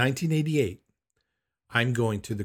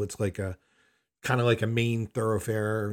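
ordinary talk, nothing marked

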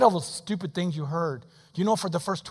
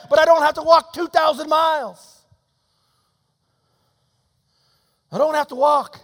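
loud, higher and pressing, fast in places; fervent preaching; 9 semitones higher, 9 dB louder than usual. Acted. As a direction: drive the point home to the congregation. Preacher soars on journey image.

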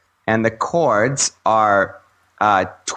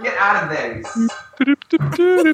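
higher-pitched scolding at a pet; real scolding; 12 semitones higher, slower than usual. Real, not acted. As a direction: shoo the cat out, pitch jumping up. Scolding cat away from trash barrel.

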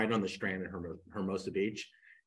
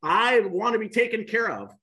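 high, loud whiny protest, laughing through it; mock spoiled whining; 7 semitones higher, 9 dB louder than usual. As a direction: play the spoiled nephew who refuses the money, half laughing. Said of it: "sliding the check back across the table and said".

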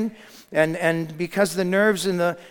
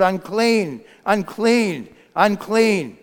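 raised, falling cry; shouted warning; 4 semitones higher, 5 dB louder, much slower than usual. Acted. Leper warning the crowd as he pushes through, calling out. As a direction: call it over a crowd. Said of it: "he continued to shout".

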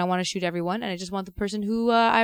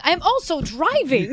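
retold yell; 7 semitones higher, slower than usual. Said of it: "I'm yelling".

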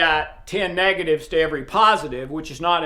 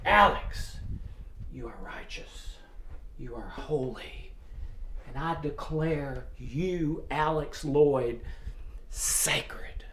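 soft, slow whispered blessing; 3 semitones lower, 9 dB quieter, slower than usual. Pretend. God whispering to Alex after his sin, softly. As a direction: whisper love to a guilty soul.

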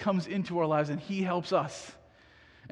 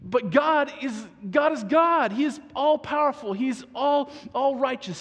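emphatic; 6 semitones higher, 5 dB louder than usual. Real, not acted. Preacher proclaims to the congregation, loud and emphatic. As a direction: build to a ringing proclamation. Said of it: "building emphasis".

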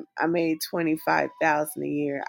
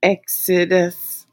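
slow dramatic Exodus; 6 dB louder, slower than usual. Pretend. Repeats Exodus, slow, louder.

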